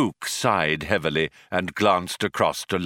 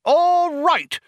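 loud, very high-pitched outburst; bawling; 5 dB louder than usual. A narrator's reading. Younger man snaps back at his colleague, bawling. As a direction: bawl it in a huff. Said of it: "bawled Vroom Fondle".

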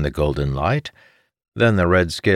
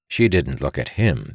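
plain reading voice

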